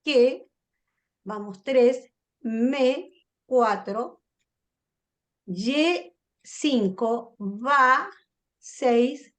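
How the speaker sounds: one syllable at a time, paused; chant-like tally, each syllable held apart; counting syllables. Shown as a demonstration. Teacher counting a verse's syllables for the class, syllable by syllable. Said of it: "tiene dos sílabas".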